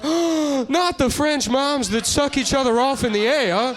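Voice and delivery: high, anguished cry of loss; hammed-up horrified gasp of grief; mock anguish. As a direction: wail it like a heartbroken lawmaker. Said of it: "he's like, gasps".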